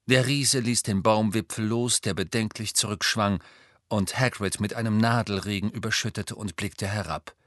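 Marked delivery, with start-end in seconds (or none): none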